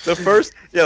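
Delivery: ordinary delivery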